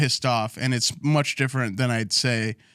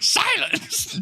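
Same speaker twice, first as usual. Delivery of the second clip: mock haughty lady. Mocking a stern waitress, hammed-up haughty indignation. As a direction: play an offended aristocrat.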